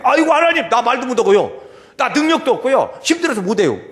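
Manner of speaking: high, loud excuse-making; hammed-up reluctant Moses pleading; acted protest. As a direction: wail your excuses up at God. Acts Moses protesting to God.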